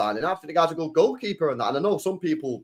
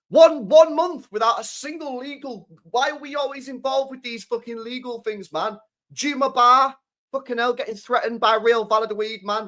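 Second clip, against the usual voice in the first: venting real frustration, voice up high; exasperated rant; 7 semitones higher than usual. Real, not acted. Fed up with legal cases.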